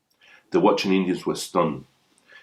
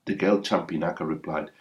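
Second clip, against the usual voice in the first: ordinary delivery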